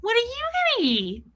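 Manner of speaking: put-on high childlike question; mock clueless self, childlike; dumb kid voice. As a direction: ask with total innocence. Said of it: "And I'm so dumb".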